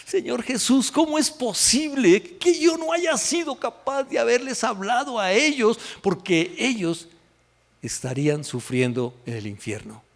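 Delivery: high, pleading cry to God; anguished crying prayer. Retold or imitated. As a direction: beg in grief over the lost friends. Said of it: "yo lloraba y lloraba y lloraba y decía".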